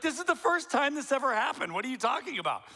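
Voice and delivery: indignant spouse protesting the first offence; mock indignant protest. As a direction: protest that it only happened once.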